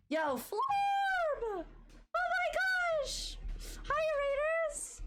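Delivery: squealed falsetto greeting; piercingly high, loud, stretched-out excited hello. Real, not acted. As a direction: shriek a drawn-out welcome to the raiders. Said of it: "my voice going, like, twenty octaves higher".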